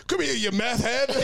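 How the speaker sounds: high, mock yell at a suspect; hammed-up chase cry; mock bounty hunter. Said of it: "and then the next is just".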